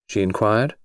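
ordinary delivery

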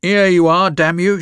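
loud, high furious snarl; old woman snarling as she hands over letters. A narrator's reading. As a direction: spit it at him in rage. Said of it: "she snarled".

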